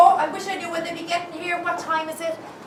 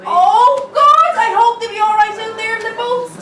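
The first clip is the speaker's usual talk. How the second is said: anxious cry; 7 semitones higher, 7 dB louder than usual. Acted. Worried woman fearing for boats.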